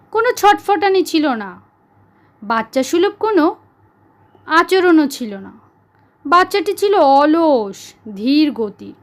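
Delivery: ordinary delivery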